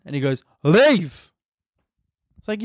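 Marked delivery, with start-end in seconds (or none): 0.6-1.3 sudden loud, higher order; mock cop dismissal, pushed out; intimidating cop. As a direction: imitate an officer sending you off. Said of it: "He's trying to be intimidating".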